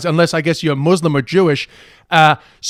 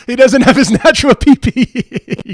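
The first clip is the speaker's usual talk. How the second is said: laughing; 6 semitones higher, 5 dB louder than usual. Real, not acted. Laughing at the joke.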